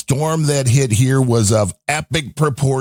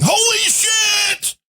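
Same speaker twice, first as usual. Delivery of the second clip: very high, drawn-out mock scream; imitated yelling; much slower than usual. Imitating a storm chaser driving through a tornado, yelling. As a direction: stretch it out like a panicked yell, not full volume. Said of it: "is yelling".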